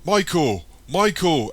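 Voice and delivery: loud, high calls after him; shouting after him. A narrator's reading. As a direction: call him back as he runs. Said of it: "Eric shouts after him".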